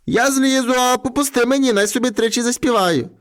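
high-pitched plea for a last wish; trapped lad bargaining; pleading boy. A narrator's reading.

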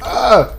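short, high, loud cry; pretend battle yell. Player fighting evil bunnies, short high yell. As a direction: yell out mid-battle in play.

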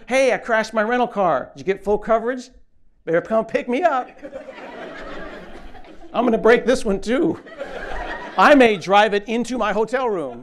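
gleefully reckless, calling out over a wrecked rental car; hammed-up careless driver calling the rental company; mock carefree renter.

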